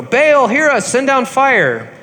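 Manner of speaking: high, loud and slow plea; crying out. Retold or imitated. Prophets begging Baal to send fire, loud slow cry. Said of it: "They're, like, crying out".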